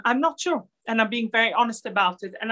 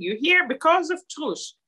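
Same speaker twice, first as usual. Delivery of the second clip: lightly imitated Dutch accent, pitched higher; 7 semitones higher than usual. Grandmother telling the grandchild why they are here, Dutch accent. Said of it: "in a very Dutch accent".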